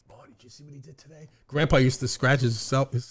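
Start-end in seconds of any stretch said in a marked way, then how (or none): 0.1-1.3 whispered imitation of gossiping kids; secretive hush. Brothers in their room gossiping about father, secretive. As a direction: whisper so father won't hear. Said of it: "so my father couldn't hear us".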